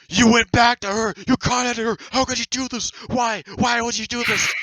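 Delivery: high, loud whiny mock freak-out. Rival freaking out over contacting the girl, high loud whiny mock. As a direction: squeal it like a hysterical accuser. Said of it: "Lopi starts freaking out".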